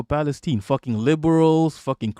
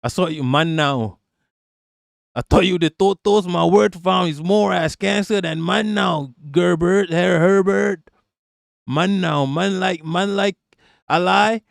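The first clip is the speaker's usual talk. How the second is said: put-on raised, playful Toronto slang accent; mock Toronto accent, pitched up; 4 semitones higher than usual. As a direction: do a hammed-up Toronto mandem accent. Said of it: "doing the Toronto accent".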